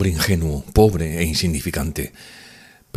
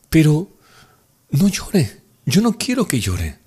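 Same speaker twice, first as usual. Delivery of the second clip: put-on higher, urgent young woman's cry; pleading exclamation, pitched up; exclaiming Nastenka; 10 semitones higher, slower than usual. A narrator's reading. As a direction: rush to stop him crying. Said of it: "exclamó Nastenka".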